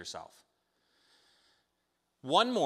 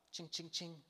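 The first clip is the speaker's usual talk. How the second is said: coin jingle; 5 semitones higher, 14 dB quieter than usual. Retold or imitated. Finding a money bag of coins.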